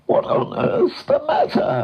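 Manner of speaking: high whinnying words with a closing snort; horse voice. A narrator's reading. Horse asking his stuck friend what happened, whinnying. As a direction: neigh the question like a horse.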